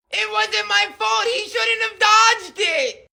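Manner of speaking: put-on bratty kid Billy. Little Billy blames brother.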